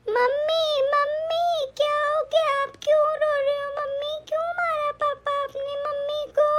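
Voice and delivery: put-on high, small child's voice, scared and pleading; a child asking mommy why papa hit her, high and scared; little girl voice. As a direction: play a frightened young daughter calling her mom.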